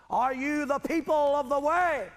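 high, loud challenge to a congregation; calling out. Real, not acted. Preacher asking God's people who they are, calling out. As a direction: throw the question out to the whole hall.

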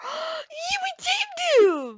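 very high, drawn-out cheer; high-pitched celebratory squeal; gleeful squeal. Real, not acted. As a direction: squeal with delight, stretching it out. Cheering at taming the hamster.

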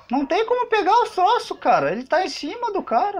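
high, panicked gamer voice; being chased. Real, not acted. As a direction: pitch up in panic as it closes in. Monster chasing him in game.

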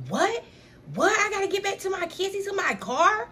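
high, panicky "what?" with rising questions; shocked disbelief. Retold or imitated. Friend learning the boy took her car, high shocked disbelief. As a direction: squeal it like she just found out her car is gone.

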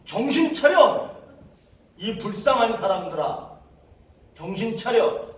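drawn-out, high, loud rebuke; startling shout. Retold or imitated. Preacher rebuking pitiable misled people, startling shout. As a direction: bellow at them, easing slightly as it goes. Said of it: "깜짝 놀래".

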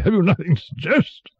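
high, testy royal question; impatient king. A narrator's reading. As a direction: demand ideas with impatience. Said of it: "said the king impatiently".

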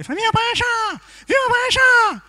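high, loud nasal campaign cheer; hammed-up honking rally yell; nasal speech impediment. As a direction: shout it through the nose. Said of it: "um gajo que é fanhoso".

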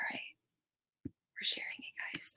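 soft whispered aside; whispering. Real, not acted. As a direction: whisper it as if off mic. Whispering screen-share steps to herself.

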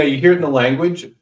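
normal talking voice

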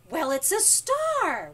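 loud, slow, high burst; thrilled high-voiced shout; high excited exclamation. A narrator's reading. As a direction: exclaim it as a big discovery. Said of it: "exclaimed in a high voice".

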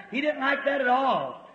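ordinary talk, nothing marked

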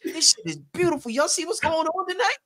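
high-pitched, thrilled gushing; gushing excitement. Real, not acted. Host delighting in guests' reunion, giddy with excitement.

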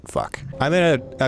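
usual speaking voice

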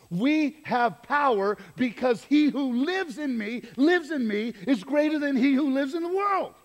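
full-voiced pulpit proclamation; preaching at full force. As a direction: lift the congregation with rising conviction.

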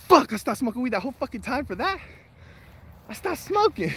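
high-pitched mock outrage; retold exasperation. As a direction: whine in disbelief at a wasted effort. Retells exasperation at needless quitting.

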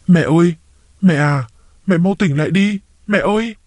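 high panicked calls to wake her; frightened pleading. Acted. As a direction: beg her to wake up, rising in fear. Finds unconscious mother-in-law bleeding.

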